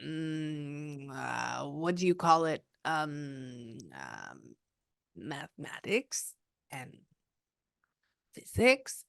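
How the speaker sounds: hesitate, search for the word, then a questioning rise on physics; grandmother's hesitant voice. Retold or imitated. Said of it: "which this is how she sounded".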